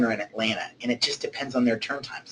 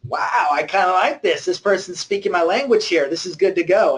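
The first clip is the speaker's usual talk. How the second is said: high, loud and delighted; pleased client, impressed and upbeat; 7 semitones higher, 4 dB louder than usual. Pretend. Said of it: "they're gonna look back at you and go".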